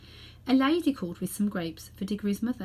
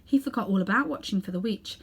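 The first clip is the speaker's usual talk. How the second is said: ordinary delivery, nothing marked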